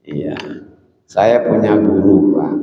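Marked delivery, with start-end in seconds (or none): none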